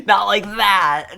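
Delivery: real, loud, slowed laughing shout; laughing protest. Real, not acted. Flustered streamer yelling at chat's teasing, loud and laughing.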